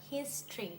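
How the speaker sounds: history as just HIS-tree; two-syllable history. Shown as a demonstration. Teacher shows it sounds like two syllables, history as HIS-tree. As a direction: drop the O in history, stressing HIS. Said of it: "มันจะเหมือนมีแค่สองพยางค์".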